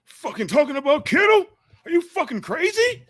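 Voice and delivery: high, loud mock outrage; a hater voiced way up high and loud; mocking internet troll. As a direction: squawk it like an angry commenter. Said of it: "some people tell me on the internet".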